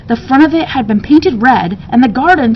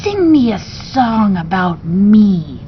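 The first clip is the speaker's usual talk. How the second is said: slithery demand; slower than usual. Acted. Beast demanding a song about itself, slow and slithery. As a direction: demand it lazily like a spoiled creature. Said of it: "demanded the beast".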